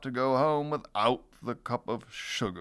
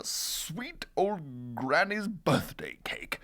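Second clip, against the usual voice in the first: plain reading voice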